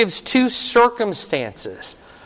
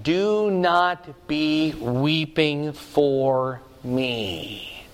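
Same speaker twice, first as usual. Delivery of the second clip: solemn warning; much slower than usual. Acted. Preacher voicing Jesus' solemn warning.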